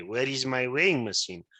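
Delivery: ordinary talk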